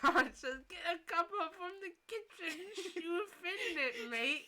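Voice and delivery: high, loud mock British accent; hammed-up British, half laughing. Trying out the villain's voice, comic British accent. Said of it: "Tuesday British accent".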